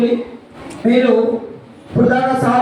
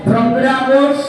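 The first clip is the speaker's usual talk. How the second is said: slow, drawn-out chanted pledge; slow group recitation; much slower than usual. Real, not acted. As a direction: recite the promise word by word. Class reciting a promise together.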